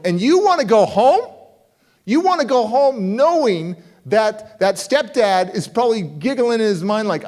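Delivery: rising, pressing disbelief building to a high cry; you want to go back there?!; incredulous challenge. Retold or imitated. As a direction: push her harder each question until it breaks high. Confronting girl about going home.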